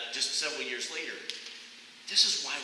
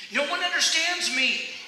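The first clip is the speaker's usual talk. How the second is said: much higher, loud wail of complaint; anguished cry; 14 semitones higher, 8 dB louder than usual. Retold or imitated. Runner wailing that nobody understands him. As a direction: cry it out to the sky. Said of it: "I cried".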